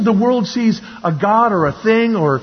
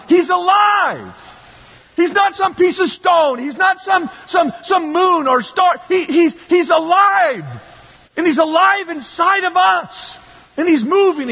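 preaching at full force; 8 semitones higher than usual. Real, not acted. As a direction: proclaim it to the back row. Preacher shouting that God lives.

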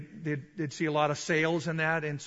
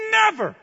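"never" very high and loud; underlined word; 14 semitones higher, 9 dB louder than usual. Shown as a demonstration. Preacher underlining the word, "never" cried high and loud. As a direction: shout "never" as one falling burst. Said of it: "underline the word".

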